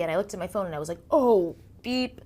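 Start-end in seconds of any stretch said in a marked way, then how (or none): 1.1-2.2 startled realization. Retold or imitated. Realizing she forgot the session.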